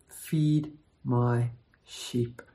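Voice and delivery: Feed my sheep drawn out very slowly and low; emphatic close. Acted. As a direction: land the final words slowly, each one weighted. Climax of the read passage.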